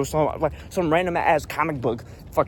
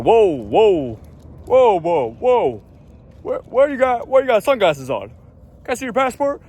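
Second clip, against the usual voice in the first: higher, louder imitation of an officer halting someone; mock officious agent; 6 semitones higher, 5 dB louder than usual. TSA agent stopping a traveler in sunglasses, mock officious alarm. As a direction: call out whoas like an overzealous agent.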